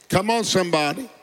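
normal talking voice

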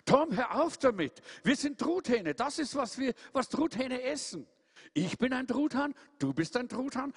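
hoch und nachdrücklich aufzählend; Truthahn, der geduldig den Bruder belehrt; belehrender Truthahn. Acted. (Hieronymus belehrt Tom, hoch und eindringlich)

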